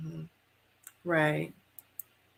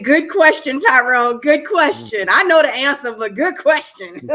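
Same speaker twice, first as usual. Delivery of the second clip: teasing; 5 semitones higher, 6 dB louder than usual. Retold or imitated. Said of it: "I was about to say".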